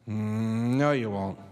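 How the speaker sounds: a dry, doubting "Mm, no, you won't."; mildly voiced ornery Moses; cynical. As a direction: scoff at their promise. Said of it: "this sort of cynicism".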